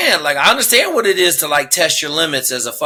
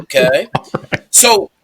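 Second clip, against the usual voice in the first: usual speaking voice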